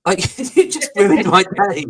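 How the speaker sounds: high, laughing outburst; laughing protest. Retold or imitated. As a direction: laugh it out in mock horror. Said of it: "And I'm like".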